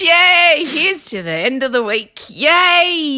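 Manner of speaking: high, drawn-out party whoop; celebratory cheer. Pretend. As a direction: cheer loudly as if raising a glass. Cheering a toast.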